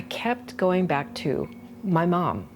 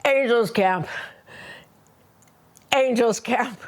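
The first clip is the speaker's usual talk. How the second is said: higher-pitched character voice, short calls with long gaps; mom; 7 semitones higher than usual. Acted. Solo performer becomes her mom for the audience, higher character voice. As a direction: act out a mother in a solo play, pitched up. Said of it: "So here's my mom".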